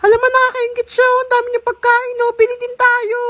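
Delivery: put-on high kid voice tugging at mom; high child voice; envious child. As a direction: whine to mom for food. Child envying food to mom.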